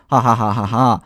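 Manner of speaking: loud spelled-out mocking ha ha ha; mock laugh. Streamer jeering at a rival's scam, loud fake laugh. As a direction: laugh at him, big and fake.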